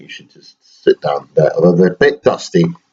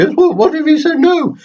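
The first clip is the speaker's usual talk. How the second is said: high squeaky toy voice, raised and bright; teddy voice. Pretend. Dad voicing teddy Toronto to his baby son, high toy voice. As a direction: squeak it out as the little bear.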